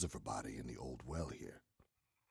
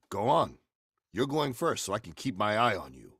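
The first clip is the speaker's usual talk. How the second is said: louder, commanding; 5 semitones higher, 8 dB louder than usual. Acted. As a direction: push her ahead forcefully. Ordering her to go first.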